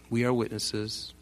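slow, soft chorus-like reply; crowd's solemn affirmation. Acted. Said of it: "their voice?".

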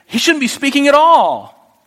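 sudden high, loud burst of disbelief; astonished thought. Retold or imitated. Preacher voicing Balaam's shock at a talking donkey, loud and high. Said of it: "as he's speaking to the donkey thinking".

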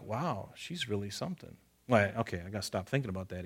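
soft, hushed inner thought; a quiet aside in David's head; David musing. Retold or imitated. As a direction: muse quietly to yourself. David's private thoughts voiced.

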